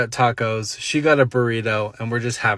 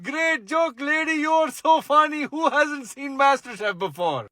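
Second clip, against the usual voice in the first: high mocking disbelief; mock laughter; 11 semitones higher than usual. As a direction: laugh her off as if she's joking. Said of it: "I thought in my head".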